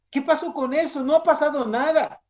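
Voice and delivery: lightly acted scoffer, pitched high; mocking. A scoffer needles a believer about the promise, mockingly. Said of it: "Y que en son de burla".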